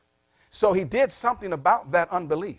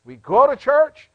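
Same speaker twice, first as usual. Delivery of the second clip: emphatic preaching; 7 semitones higher, 10 dB louder than usual. Real, not acted. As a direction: proclaim it big and high, rising at the end. Preacher repeats the loud contrast.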